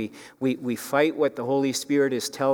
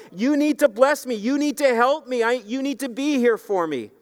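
desperate plea; 11 semitones higher than usual. Acted. Jacob holding on to God, begging for blessing. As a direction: demand help like a drowning man. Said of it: "he is crying".

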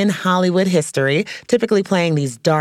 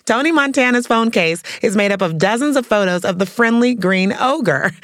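ordinary talk

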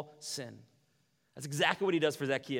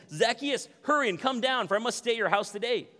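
calling out; 9 semitones higher, 7 dB louder than usual. Acted. Jesus calls up to tree.

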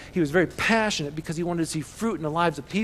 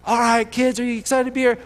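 put-on high, loud hype for school kids; pitched-up, punchy assembly greeting; pep rally host; 7 semitones higher, 7 dB louder than usual. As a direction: fire up a crowd of elementary kids. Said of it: "this is the way it works".

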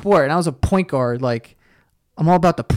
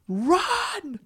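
coach yelling; 12 semitones higher, 7 dB quieter than usual. Retold or imitated.

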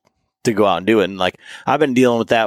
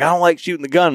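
higher, light hint of a little girl; imitating young daughter; 8 semitones higher, faster than usual. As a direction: lightly mimic the seven-year-old daughter, pitched up. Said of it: "but she's like".

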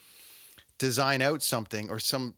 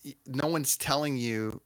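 ordinary talk, nothing marked

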